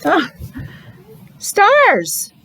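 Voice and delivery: high, drawn-out delighted exclaim; excited reveal. Acted. Teacher showing her class the new card, high excited reveal. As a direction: announce the card like a treasure.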